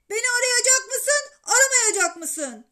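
high, loud impatient demand; pushy boy bellowing a question; shouting. A narrator's reading. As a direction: yell it down the phone. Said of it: "Alcest yine bağırmaya başladı".

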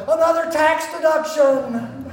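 high, loud, gleeful punchline; joking excitement. Retold or imitated. Father phoning his accountant, loud mock glee. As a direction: cheer it like a winning ticket. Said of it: "I was really excited".

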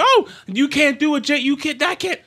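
high-pitched sputtering mimicry of the outraged crowd; mock outrage. Host imitating everyone losing their mind over Bond, mock outrage. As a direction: splutter it like people losing their minds. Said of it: "everyone loses their mind".

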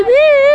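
high loud woo like a siren; siren imitation. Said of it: "The sound of the police".

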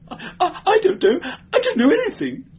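very high, stammering panic; growing hysterical. A narrator's reading. Cornered clerk denying all, rising hysteria. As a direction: let the denial climb into hysteria. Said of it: "Promero was growing hysterical".

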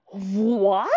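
drawn-out rising "what?", hammed up; a bigger, incredulous "whaaat?"; exaggerated disbelief. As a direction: stretch it in mock astonishment. Repeats stunned reaction, bigger.

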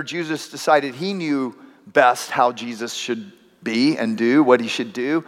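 usual speaking voice

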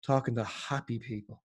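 imitated weary tone. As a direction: dryly mimic his disbelief, softly. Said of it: "then he said in this tone".

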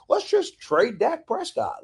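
mocking fan voice. Mocking fans' trade calls.

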